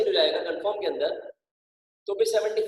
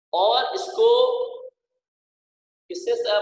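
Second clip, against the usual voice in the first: ordinary talk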